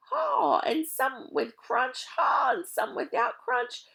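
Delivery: high, slow, giggling list; laughing. Acted. Mac mocks the thieves listing candy, laughing high. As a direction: savour each item through laughter.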